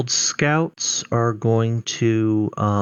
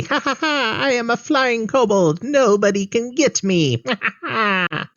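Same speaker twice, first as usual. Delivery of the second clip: gleeful kobold cackle; 13 semitones higher than usual. Acted. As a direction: cackle with glee like a taunting goblin. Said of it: "he cackles with glee".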